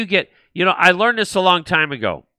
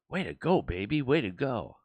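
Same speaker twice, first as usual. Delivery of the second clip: softer, lower mock gloat under the breath; secret gloating; 6 semitones lower, 13 dB quieter than usual. School board privately cheering a teacher, muttered gloat. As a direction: gloat to yourself where nobody hears. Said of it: "In the back of their mind, they're going".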